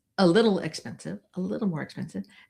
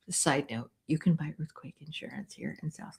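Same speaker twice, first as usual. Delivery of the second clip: whisper; 3 semitones lower, 11 dB quieter than usual. Real, not acted. Confiding side note.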